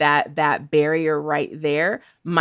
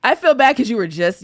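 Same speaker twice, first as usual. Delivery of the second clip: ordinary talk, nothing marked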